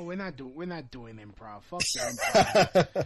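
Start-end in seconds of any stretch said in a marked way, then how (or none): 1.6-3.1 loud, slow, laughing it out; laughing shout. Real, not acted. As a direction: bark it through a laugh. Laughing outburst against improv.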